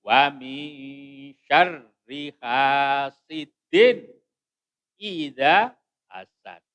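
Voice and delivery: slow, loud measured recitation; Quran recitation. Shown as a demonstration. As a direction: stretch the long vowels and nasal holds.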